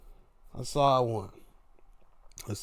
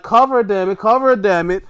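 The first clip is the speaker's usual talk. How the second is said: loud, raised-pitch urging; impatient fan's shout, re-enacted; urgent yelling; 7 semitones higher, 11 dB louder, faster than usual. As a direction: yell at the wrestler to make the pin. Urging the pin while watching.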